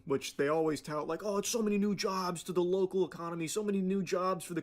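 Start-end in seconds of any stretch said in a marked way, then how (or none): none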